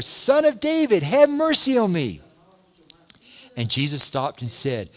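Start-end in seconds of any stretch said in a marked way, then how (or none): 0.0-2.2 high, loud desperate cry; crying out louder. A narrator's reading. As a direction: call out again, even more urgently. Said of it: "he kept crying out more".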